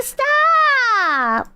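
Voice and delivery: high mock cry of "Stop!"; a lightly done copy of her cadence; imitating her stop. Streamer mimics the woman yelling stop, high mock cry. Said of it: "she has this specific cadence".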